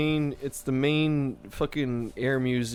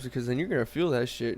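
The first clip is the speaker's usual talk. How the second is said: normal talking voice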